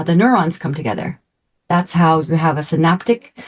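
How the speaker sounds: ordinary talk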